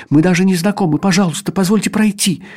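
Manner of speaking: frightened plea. Acted. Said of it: "неожиданно испугавшись".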